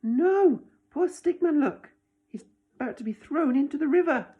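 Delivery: high, loud mock alarm for the children. A narrator's reading. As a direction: gasp out worry for poor Stickman. Narrator alarmed for Stickman.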